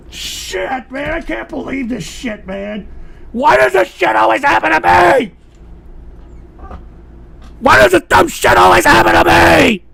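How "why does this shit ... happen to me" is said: raised, wailing angry yell; anguished complaint. Real, not acted. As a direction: shout it as a furious lament. Anguished complaint at bad luck.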